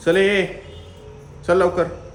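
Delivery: loud, high call to the cat. Real, not acted. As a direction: call the cat in from across the terrace. Calling the cat home. (मांजरीला मोठ्याने हाक)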